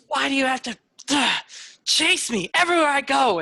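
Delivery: high, loud frantic plea; cornered sister begging her tormentor, frantic and high; desperate. Acted. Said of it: "[name] is desperate, wide-eyed".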